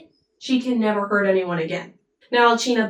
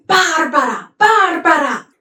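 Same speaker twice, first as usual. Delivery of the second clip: "Barbara" flung out twice, higher and louder; accusing cry; 7 semitones higher, 7 dB louder than usual. Retold or imitated. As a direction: hurl "Barbara" at the cruel woman. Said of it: "He's like".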